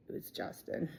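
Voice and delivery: whispered, barely audible realization; a hushed whisper as it sinks in; stunned whisper. Retold or imitated. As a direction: breathe the name out in shock. Realizing who died.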